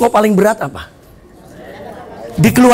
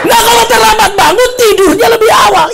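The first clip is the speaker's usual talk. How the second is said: high, loud, exasperated acted scolding; angry rebuke; 11 semitones higher, 5 dB louder than usual. Teacher rejecting oversleeping excuse, scolding. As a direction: hammer the latecomer with raised pitch.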